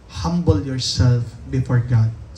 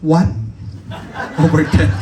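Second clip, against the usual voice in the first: laughing; 4 dB louder than usual. Retold or imitated. Proudly reporting his bad score.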